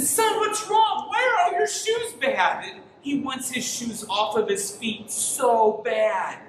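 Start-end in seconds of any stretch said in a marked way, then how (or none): 0.0-2.6 exasperated dad. Retold or imitated. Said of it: "I said".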